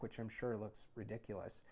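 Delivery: plain reading voice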